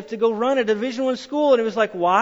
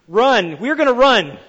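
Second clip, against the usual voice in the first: loud barked order to run; coach shout; 3 semitones higher, 9 dB louder, slower than usual. Retold or imitated. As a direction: yell it like a coach at practice.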